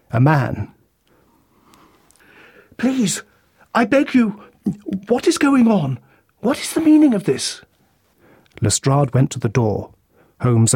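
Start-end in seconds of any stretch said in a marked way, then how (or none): 2.8-6.0 high, pleading, frightened voice; a man's alarmed plea much higher than the narration; desperate pleading husband. A narrator's reading. Newcomer husband pleads at crime scene. 6.4-7.6 high, alarmed "What is the meaning of this?" falling off "meaning"; frightened husband asking what happened; alarmed demand. A narrator's reading. Husband demands answer at crime scene.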